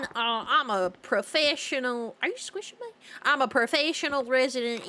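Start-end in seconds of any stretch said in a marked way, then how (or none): 2.2-2.9 high startled question; surprised. Real, not acted. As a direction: squeak it out surprised.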